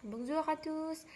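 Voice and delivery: high French teacher greeting; chirpy class greeting in French; teacher's greeting. Retold or imitated. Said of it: "guru bilang".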